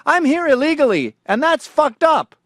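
high-pitched caricature of a protester; mock complaining immigrant.

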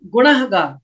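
ਗੁਨਾਹਗਾਰ with the h sounded; correct reading. Shown as a demonstration. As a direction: open "naah" in the middle of ਗੁਨਾਹਗਾਰ. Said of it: "ਇਹਨੂੰ ਗੁਨਾਹਗਾਰ ਪੜ੍ਹਨਾ".

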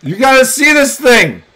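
high, loud summons; calling out. Real, not acted. Parent calls Mama to see the dragon, calling out. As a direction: holler excitedly for someone to come.

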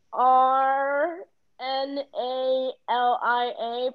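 usual speaking voice

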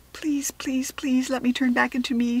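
pleading whisper; begging the wind under her breath; hushed desperate plea. Acted. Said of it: "she cried to the wind".

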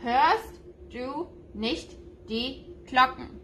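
"Hörst du nicht die Glocken" drawn out slowly, every word clear; slow German demo. Shown as a demonstration. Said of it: "in German it would be".

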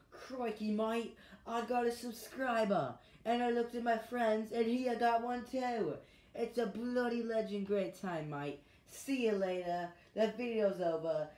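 high, loud, hammed-up Aussie accent; Australian accent. Shown as a demonstration. YouTuber demonstrating his accent for viewers, exaggerated Australian. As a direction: put on a big, broad Australian voice. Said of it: "here's my Australian accent".

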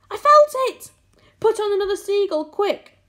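very high, loud, urgent cries; excited shouting. A narrator's reading. Crew sure the peach is lifting, excited shouting.

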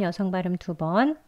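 normal talking voice

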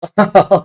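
short exasperated laugh; sinirli gülme. Real, not acted. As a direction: burst into a brief laugh of disbelief. Sinir bozukluğuyla gülüyor. (kısa, sinir bozukluğundan kahkaha)

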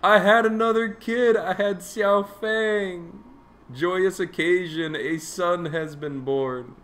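high, slow, joyful proclamation; excited announcing. Pretend. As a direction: proclaim the birth with glee. Announcing a newborn heir.

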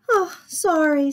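put-on high, loud little-kid sorry; sheepish apology. Sammy says sorry to the children, childlike apology.

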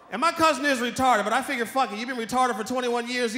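ordinary talk, nothing marked

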